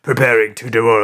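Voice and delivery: low supervillain voice; mock-evil low growl. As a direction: switch into a deep, menacing cartoon villain. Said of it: "evil voice".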